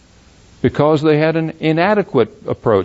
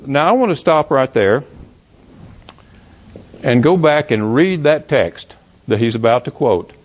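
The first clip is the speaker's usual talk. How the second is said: normal talking voice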